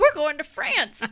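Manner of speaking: loud, high hammed-up dread; imitating her own panic, loud; mock panic. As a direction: retell the fear with a laugh behind it. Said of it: "I'm thinking".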